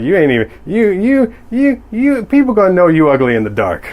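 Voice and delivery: put-on high, squeaky, creepy old alien; Master Yo-Dog voice. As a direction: sneer it like a lecherous little alien sage. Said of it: "doing the Master Yo-Dog voice in my head".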